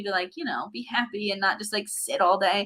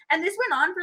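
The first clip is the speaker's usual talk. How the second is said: ordinary talk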